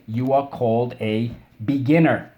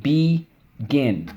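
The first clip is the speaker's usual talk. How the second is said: "Begin" split slowly into be, gin; syllable by syllable; 3 semitones higher than usual. Shown as a demonstration. Teacher leads class through the spelling word, syllable by syllable. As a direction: say "Begin" as two separate drawn-out syllables. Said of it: "let's go".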